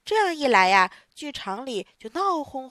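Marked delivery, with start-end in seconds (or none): none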